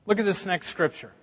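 usual speaking voice

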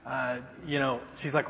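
ordinary talk, nothing marked

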